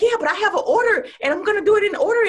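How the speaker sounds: high, loud, fast near-crying panic; panicked wail. Retold or imitated. Speaker panics to husband when the slides won't work, high near-crying wail. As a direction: wail it fast as if the show is collapsing.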